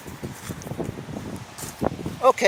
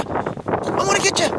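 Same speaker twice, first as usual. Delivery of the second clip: high playful squeal; 11 semitones higher than usual. Acted. Playing chase with dog.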